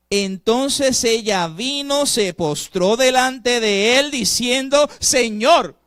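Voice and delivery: loud, slow, rising sermon voice; fervent preaching. Acted. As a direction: build the story to a preached climax. Preaching at full force. (predicando con fuerza)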